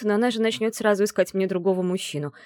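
usual speaking voice

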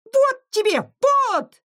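tiny, very high squeaky yell; thin little shout. A narrator's reading. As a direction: yell in a tiny voice while landing blows. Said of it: "кричал кто-то тоненьким голоском". (крик тоненьким голоском)

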